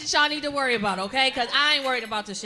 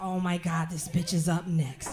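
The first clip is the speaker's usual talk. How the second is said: low, muted grumble; mock dread, pitched down; imitated dreading bill collectors; 8 semitones lower, 4 dB quieter than usual. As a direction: say it low to a coworker. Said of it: "I know them motherfuckers be like".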